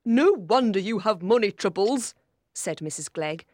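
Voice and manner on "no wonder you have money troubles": loud, critical aunt; mildly acted carping relative; disapproving aunt. A narrator's reading. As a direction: scold with a raised voice.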